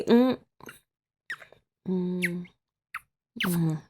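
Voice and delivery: ordinary delivery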